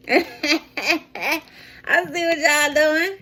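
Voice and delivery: slow, high, laughing; drawn-out laughing tease. Pretend. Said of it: "that sounded like an evil witch laugh".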